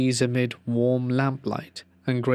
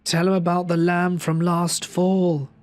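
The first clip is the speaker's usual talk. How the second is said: higher-pitched grandmother calling from another room; 6 semitones higher, 4 dB louder than usual. A narrator's reading. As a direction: call it through from the kitchen. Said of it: "as my grandmother called from the kitchen".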